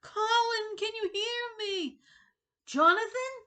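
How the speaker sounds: high, loud desperate calling; searching for his friend, yelling. Acted. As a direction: call out to find him in the dark. Calling across flooded corridors.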